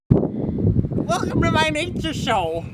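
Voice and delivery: high, drawn-out welcome; hammed-up show host. Nature host promising to show how to survive, hammed-up. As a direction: stretch out the intro in a high voice. Over-the-top show intro.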